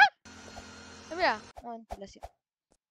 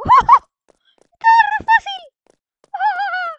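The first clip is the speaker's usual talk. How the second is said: triumphant; 17 dB louder, much slower than usual. Real, not acted. Celebrates escaping.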